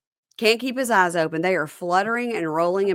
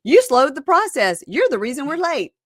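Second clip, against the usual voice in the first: high, loud blame-throwing; retold accusation; 6 semitones higher, 4 dB louder than usual. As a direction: throw the blame back in a mock argument. Retold argument, throwing blame back.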